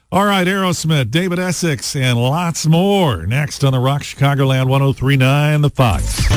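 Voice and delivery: ordinary delivery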